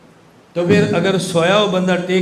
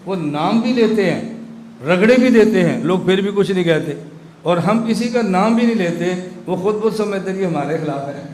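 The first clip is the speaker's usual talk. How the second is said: usual speaking voice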